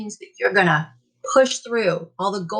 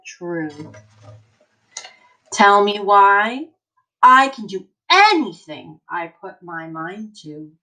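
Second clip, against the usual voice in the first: put-on slow, emphatic read-aloud for kids; reading to children; 2 semitones lower, 3 dB louder than usual. Teacher reading an encouraging book to her class, emphatic. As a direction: read it like a rousing picture book.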